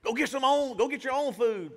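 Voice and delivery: high, scornful brush-off; dismissive bystander. Retold or imitated. Preacher mimics people refusing the hungry man, high and scornful. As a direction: push the hungry man away, sneering. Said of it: "Everybody's like".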